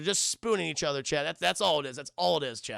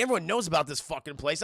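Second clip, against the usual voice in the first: ordinary talk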